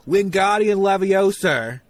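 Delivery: slow Cockney-ish "Wingardium Leviosa" with an R at the end; Cockney-ish accent. Retold or imitated. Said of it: "a Cockney-ish accent".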